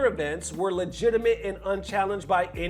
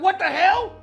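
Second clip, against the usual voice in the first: mock outrage; 11 semitones higher, 6 dB louder than usual. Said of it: "And I'm like".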